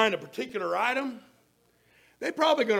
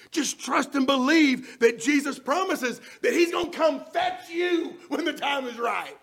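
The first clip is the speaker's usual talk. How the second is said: loud, higher, repeated shouted appeal; preaching at full force; 5 semitones higher, 6 dB louder than usual. Acted. Preacher urging the congregation to trust, in a loud shouted appeal. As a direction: urge them on with rising force.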